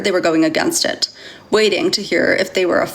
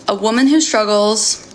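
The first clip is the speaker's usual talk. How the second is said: usual speaking voice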